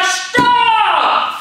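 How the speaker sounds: high, loud commanding STOPP; loud stop shout. Shown as a demonstration. Coach shouting stop to show the kids. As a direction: bark stop with the hand out. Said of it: "rufen wir ganz laut Stopp".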